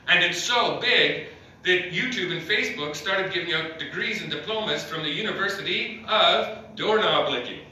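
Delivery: ordinary talk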